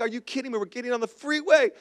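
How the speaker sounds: mock outrage kept under the breath; soft, incredulous retold complaint; retold angry disbelief. Scooter rider retelling his anger at his friend, hushed mock outrage. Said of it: "I'm so angry at him".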